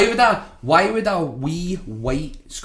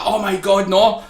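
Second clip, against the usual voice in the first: hammed-up panto horror, a touch louder; mock dismay; 7 semitones higher, 6 dB louder than usual. Manager faking horror as his wrestler kicks out. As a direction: fake distress for the crowd. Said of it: "I'm supposed to be like".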